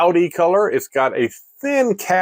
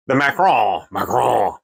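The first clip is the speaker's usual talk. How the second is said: mock French; 4 semitones lower than usual. Shown as a demonstration. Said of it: "my French accent".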